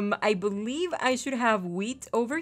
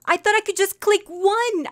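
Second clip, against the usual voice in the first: whiny dismay; 9 semitones higher, 6 dB louder than usual. Real, not acted. Repeated complaint.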